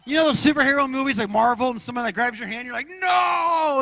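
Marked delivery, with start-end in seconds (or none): 2.9-3.8 higher, loud mock cry of pain; mock yell. Pastor acting out his hand being crushed, mock yell. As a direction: yelp as the Hulk grip crushes you.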